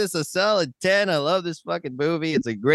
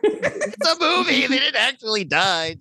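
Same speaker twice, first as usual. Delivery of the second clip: high-pitched, laughing exasperation; laughing protest; 9 semitones higher than usual. Real, not acted. Host joking about killed movie kids, laughing protest.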